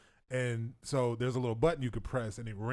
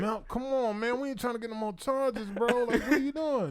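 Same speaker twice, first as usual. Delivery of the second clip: pleading friends; 9 semitones higher than usual. Retold or imitated. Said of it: "We're like".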